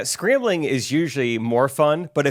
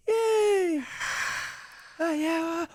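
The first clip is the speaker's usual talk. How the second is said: mock cheer; 12 semitones higher, 10 dB quieter, much slower than usual.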